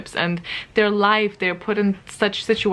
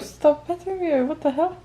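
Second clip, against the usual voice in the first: laughing; 7 semitones higher than usual. Real, not acted. Cat pawing her, laughing.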